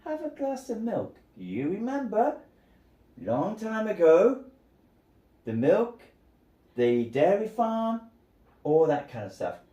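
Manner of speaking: a mock-friendly taunt about milk; teasing. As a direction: dangle the hint playfully. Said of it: "he's teasing her".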